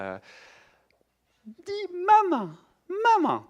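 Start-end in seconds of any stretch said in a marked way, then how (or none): 1.4-3.4 put-on very high, slow sing-song coaxing a baby; high, drawn-out baby-talk voice; mother's baby talk. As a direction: coo at the baby like a doting mother. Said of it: "ça ressemble à ça".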